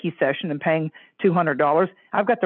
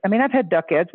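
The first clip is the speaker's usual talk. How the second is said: ordinary delivery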